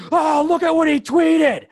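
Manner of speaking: high, loud, slow mocking shout; shrill out the outrage like a gossip digging up old tweets; hammed-up pearl-clutching yell; mocking outraged tweet-diggers. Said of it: "they're like".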